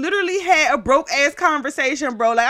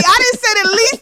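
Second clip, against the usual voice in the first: normal talking voice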